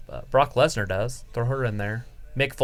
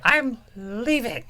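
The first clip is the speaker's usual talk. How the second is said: high, slow whiny falsetto; whiny girl voice; 10 semitones higher, slower than usual. Retold or imitated. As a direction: drag it out like a pouting girl. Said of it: "If it doesn't go my way".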